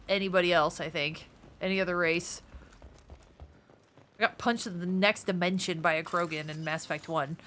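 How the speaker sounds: ordinary delivery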